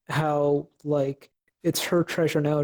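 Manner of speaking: usual speaking voice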